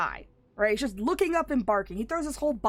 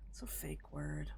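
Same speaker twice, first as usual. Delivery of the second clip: breathy whispered aside, much softer; 6 semitones lower, 14 dB quieter than usual. Real, not acted. Aside to viewers about the fake word, whispered. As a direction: drop to a whisper for the joke.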